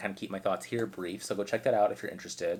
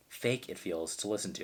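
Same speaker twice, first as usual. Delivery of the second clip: ordinary talk, nothing marked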